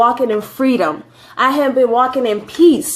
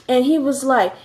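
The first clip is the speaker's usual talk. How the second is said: ordinary talk, nothing marked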